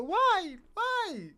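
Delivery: high whiny wail; mock sob of a betrayed voter; mock crying voter. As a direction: imitate her tearful pleading. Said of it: "That's all you hear".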